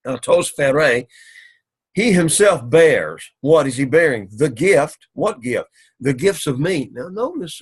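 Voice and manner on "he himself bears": slow, louder he himself bears; he himself bears, drawn out and raised; stress on he himself. Shown as a demonstration. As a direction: press on he himself. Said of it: "The emphasis here is upon he himself".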